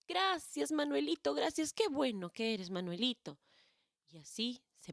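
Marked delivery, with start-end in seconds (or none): 0.1-3.4 put-on very high, louder happy little kid; overjoyed child thanking a friend; grateful child. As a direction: squeal your thanks like a relieved boy.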